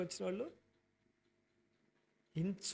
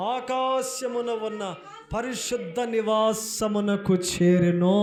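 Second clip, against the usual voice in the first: emphatic slow reading; 11 dB louder, slower than usual. Acted. Preacher finishing the scripture reading.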